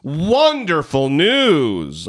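slow, exaggerated sing-song sarcasm; mock delight laid on thick; sarcastic. Real, not acted. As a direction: draw out the fake enthusiasm, pitch swooping. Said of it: "I hope you can sense the sarcasm".

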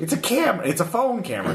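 high-pitched flustered protest; high defensive voice. Acted. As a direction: squeak out the excuse.

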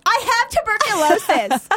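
high loud mock shout; mock yell. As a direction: yell I have tuberculosis. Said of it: "pull over and be like".

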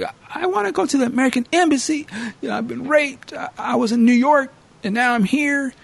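high, fast put-on pleading voice; mock frantic woman, pitched high; victim pleading.

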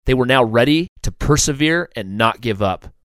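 usual speaking voice

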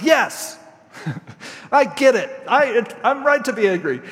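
sulky insistence, hammed up; indignant prophet, playfully overdone; petulant Jonah. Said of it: "he's like".

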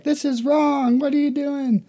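high, mock-horrified kids' cry; mock upset kids. Youth group kids protesting the sawing, mock-horrified. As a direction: squeal it like horrified children. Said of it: "All the kids are, like, crying".

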